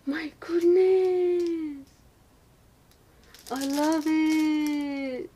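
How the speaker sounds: gasp, then high, slow, loud squeal; overwhelmed delight. Real, not acted. Vlogger loving the brush set packaging, overwhelmed delight. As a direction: wail it out long and high in delight.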